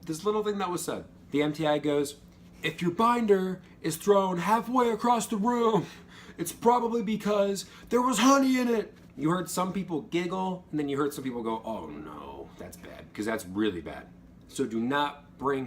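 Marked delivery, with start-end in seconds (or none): 2.6-8.9 drill instructor yell. Retold or imitated. MTI yelling about the honey. 11.7-13.1 hushed dread. Retold or imitated. Recruits reacting in dread.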